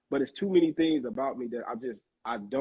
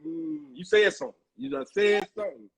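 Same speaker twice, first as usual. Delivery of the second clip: mock church agreement; 7 dB louder than usual. Listener affirming a speaker in church, mock emphatic agreement. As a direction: imitate an emphatic church amen-style response. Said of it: "they be like".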